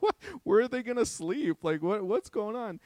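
high, disbelieving outburst; can't believe what they're hearing; incredulous. Retold or imitated. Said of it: "We're like".

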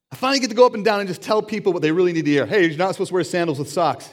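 mock eager critic, rushing and loud; gleeful bluntness. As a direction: blurt it out as if finally let loose.

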